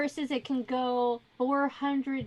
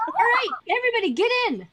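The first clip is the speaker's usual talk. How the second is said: quick, high, raised voice; rally everyone briskly; hurried call aboard; 9 semitones higher, 5 dB louder, faster than usual. Acted. Driver calling the party into the carriage, urgent shout.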